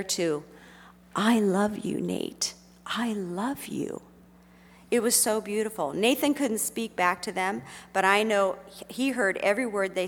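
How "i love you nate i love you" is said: tender, slowing on the repeat; heartfelt goodbye. Retold or imitated. Friends saying goodbye to dying Nathan, heartfelt goodbye. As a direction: say it sincerely, second time slow and lingering.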